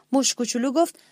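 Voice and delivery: plain reading voice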